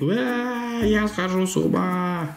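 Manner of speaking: slow, loopy zombie voice. Pretend. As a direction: drawl like a crazed zombie. Voicing the crazy janitor zombie.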